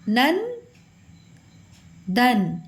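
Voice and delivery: ordinary talk, nothing marked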